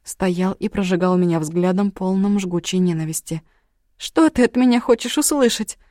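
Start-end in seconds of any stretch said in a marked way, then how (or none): 4.0-5.8 shouting through tears, pitch up, loud; angry crying. Acted. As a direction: yell it while sobbing. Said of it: "закричала я сквозь слёзы".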